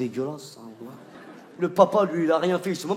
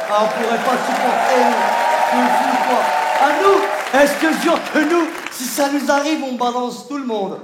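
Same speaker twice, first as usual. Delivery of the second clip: mock panic; 6 semitones higher, 9 dB louder than usual. Imagining men giving birth.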